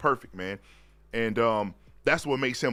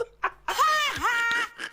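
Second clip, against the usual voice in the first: cracking up at a comment; high laugh. Real, not acted. Reacting to the chat comment.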